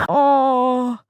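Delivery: sharp, high gasp, then oh; a real shocked gasp. Real, not acted. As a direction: caught off guard by sadness. Reacting to a sad story.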